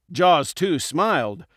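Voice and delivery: ordinary delivery, nothing marked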